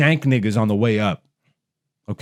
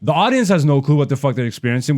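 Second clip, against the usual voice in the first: ordinary talk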